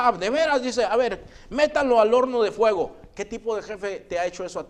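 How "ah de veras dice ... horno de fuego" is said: high, loud royal order, acted out; angry king. King sending the three to the furnace, angry command. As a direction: play a king sentencing defiant prisoners.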